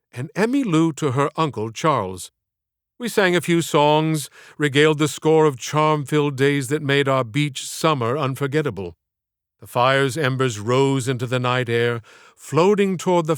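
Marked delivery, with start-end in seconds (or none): none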